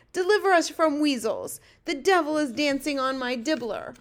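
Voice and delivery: high, loud desperate exclamation; fury and anguish. A narrator's reading. Giant rants about the nightmare he caught, anguished. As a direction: cry for deliverance, appalled. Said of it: "His voice was filled with fury and anguish".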